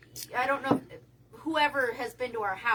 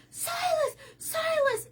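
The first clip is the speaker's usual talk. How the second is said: high frantic yell of the dog's name; screaming for the dog; 3 dB louder, slower than usual. Retold or imitated. Retelling the bear scare, yelling for the dog. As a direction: scream his name in panic. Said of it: "And I started screaming".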